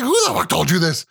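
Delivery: hammed-up outrage; retold anger. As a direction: play a mad dad demanding answers. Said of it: "at first I was mad".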